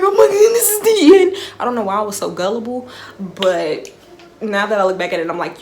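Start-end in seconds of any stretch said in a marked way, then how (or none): 0.0-1.4 high, loud hammed-up wail; mock crying. Frantic friends on the phone, hammed-up crying. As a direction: wail it like doomsday, overdone. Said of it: "We on the phone crying like".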